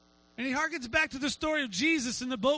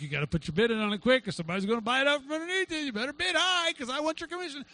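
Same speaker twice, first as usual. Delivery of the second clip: pushy realtor; faster than usual. Retold or imitated. Realtor hurrying a buyer to bid, pushy.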